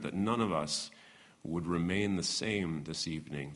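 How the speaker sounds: soft, low prayer voice. Acted. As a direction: pray quietly and reverently. Leads a prayer.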